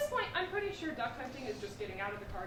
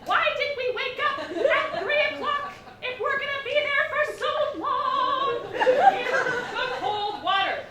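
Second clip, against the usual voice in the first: much higher and louder, slowed, sing-song; exasperated rant; 11 semitones higher, 10 dB louder, slower than usual. Real, not acted. Comic complains to the crowd about the early wake-up, loudly. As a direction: belt out the complaint in disbelief. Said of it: "exasperated rant".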